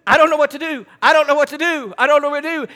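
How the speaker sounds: helpless panic. Retold or imitated. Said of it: "I'm sitting there going".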